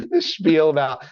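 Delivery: ordinary delivery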